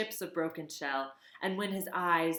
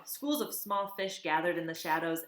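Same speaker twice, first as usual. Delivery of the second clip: ordinary delivery, nothing marked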